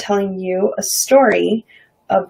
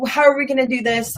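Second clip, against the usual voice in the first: higher-pitched worried question; mock freak-out; 6 semitones higher than usual. Facing a fifteen hundred dollar bill, mock freak-out. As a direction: voice a mild money panic. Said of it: "freak out mode".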